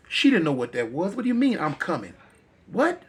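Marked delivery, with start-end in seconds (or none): 1.1-3.0 confused. Acted. Camille confused by the voice.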